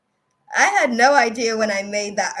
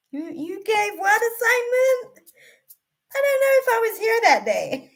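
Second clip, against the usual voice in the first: much higher, sheepish schoolgirl voice; mock clueless student. Retelling excuses to God as a clueless student. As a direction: play the kid who missed class. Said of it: "I'm like".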